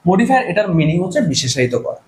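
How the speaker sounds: normal talking voice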